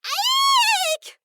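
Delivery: very high squealing shriek; high-pitched terrified scream. A narrator's reading. Startled genie shrieking inside the fish. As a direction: squeal in sheer fright. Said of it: "he screams in a terrified, high-pitched voice".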